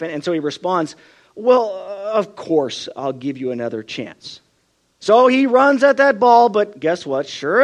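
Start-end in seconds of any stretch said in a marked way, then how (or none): 1.4-4.4 moved, forgiving reply. Retold or imitated. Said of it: "he's moved by her display of grief".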